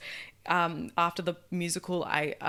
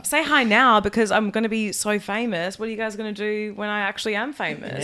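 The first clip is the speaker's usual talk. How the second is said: mock diva; 9 dB louder than usual. Joking about future fame.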